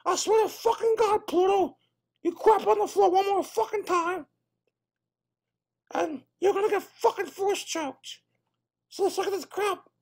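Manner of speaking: a cartoon mouse threatening his dog; angry Mickey Mouse.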